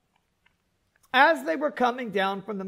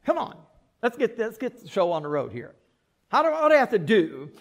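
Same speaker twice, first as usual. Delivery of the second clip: louder, fast, exasperated rant; impatient outburst; 5 dB louder, faster than usual. Retold or imitated. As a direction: hurry them up, out of patience. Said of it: "I don't know how it sounded".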